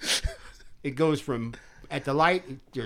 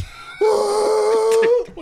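mock screech; 5 dB louder than usual. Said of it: "He fucking just goes".

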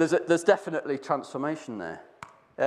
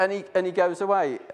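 normal talking voice

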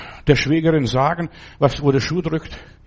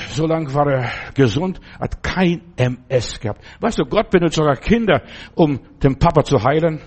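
ordinary talk, nothing marked

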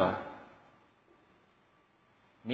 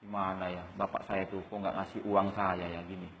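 low, hushed grumble about a father; child confiding a complaint; 7 semitones lower, 6 dB quieter than usual. Acted. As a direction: confide it quietly to a neighbour. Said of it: "terus cerita sama Pak Nawawi". (anak curhat mengeluh dengan suara pelan)

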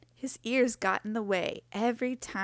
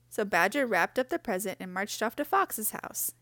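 ordinary delivery

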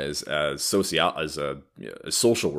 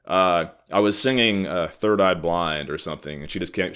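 normal talking voice

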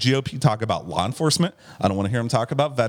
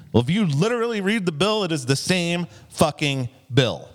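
real exasperation, opening high then slowing to hammer it; indignant, leaning hard on each word; worked up; 6 semitones higher than usual. Real, not acted. Host rebutting online know-it-alls about the bill, worked up. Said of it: "I'm fucking worked up tonight".

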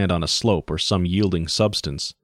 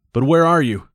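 higher, louder frantic question; hectic query; 8 semitones higher, 4 dB louder than usual. Acted. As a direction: ask in a frantic rush. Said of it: "the pilot's hectic query".

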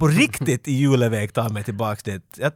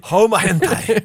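laughing joke title; 6 semitones higher, much slower than usual. Real, not acted.

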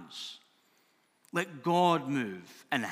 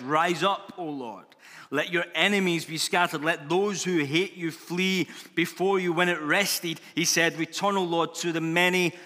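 raised, louder declamation of a prayer; proclaimed scripture; 4 semitones higher, 5 dB louder than usual. Acted. Moses calling on the Lord as the ark sets out, proclaiming. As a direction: call it out as a rousing invocation. Reads Moses' cry to God.

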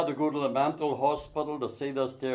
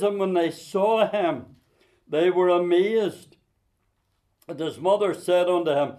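scripture reading; 2 semitones higher, 5 dB louder than usual. Acted. Reading Luke aloud in preaching.

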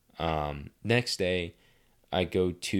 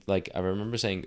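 normal talking voice

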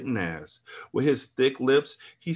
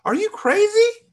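shouting; 6 dB louder than usual. A narrator's reading. Woman fighting off the trainer, high shriek. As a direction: shriek it up high, pushing him off. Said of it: "I shouted to the top of my lungs".

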